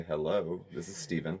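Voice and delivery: polished work phone greeting. Shown as a demonstration. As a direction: answer like a professional on the phone. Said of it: "that's my phone voice at work".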